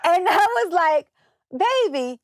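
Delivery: tearful high voice, choked up; crying from a surprise gift; touched crying. Real, not acted. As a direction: voice breaking with happy tears. Overcome by a thousand-dollar gift.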